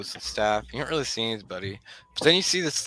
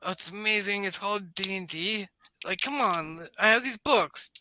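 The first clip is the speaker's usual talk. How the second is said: put-on high-pitched enthusiastic kid gamer; Tony, eager; 9 semitones higher than usual. Game master as Tony offering to introduce his DM, eager. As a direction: chatter keenly in a raised kid voice.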